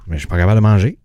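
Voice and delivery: talking with a sore mouth after wisdom teeth. Real, not acted. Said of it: "deux dents de sagesse en moins".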